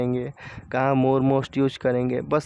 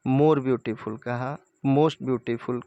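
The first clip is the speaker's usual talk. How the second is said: more beautiful and most beautiful said very slowly; slow teaching example; much slower than usual. Shown as a demonstration. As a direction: spell the forms out slowly for students. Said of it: "beautiful तो more beautiful". (बहुत धीरे समझाते हुए)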